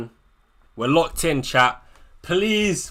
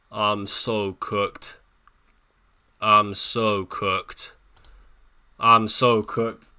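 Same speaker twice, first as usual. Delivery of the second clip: very slow, lower and softer; despairing groan; 7 semitones lower, 4 dB quieter, much slower than usual. Real, not acted. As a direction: drag each word out in defeat. Despairing over his team pick.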